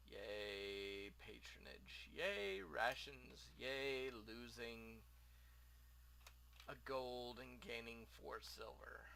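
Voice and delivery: sarcastic, listless hooray; deadpan mock cheer. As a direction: cheer half-heartedly, dropping away. Mock cheering own purchase.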